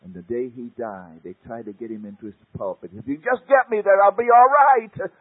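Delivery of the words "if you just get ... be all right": desperate plea, much higher and louder; sick preacher crying out to be carried up; pleading cry. Retold or imitated. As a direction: beg them to get you to the pulpit. Dying preacher begs to reach pulpit.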